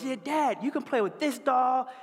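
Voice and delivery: put-on high, gleeful child voice; high child's voice offering dad a doll; happy little girl. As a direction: squeal it like a delighted little girl.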